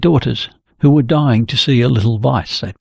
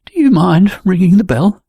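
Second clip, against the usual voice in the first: put-on a higher, bashful young woman's request; shy blushing ask; 6 semitones higher than usual. A narrator's reading. Jenny asks Gunnar to ring the bell, blushing and shy. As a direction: ask it shyly, half embarrassed. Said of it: "saying with a blush".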